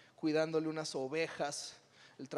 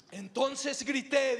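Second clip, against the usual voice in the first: Mexican-style shout; 6 semitones higher, 3 dB louder, much slower than usual. Shown as a demonstration. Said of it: "Imagínate este grito en un mexicano".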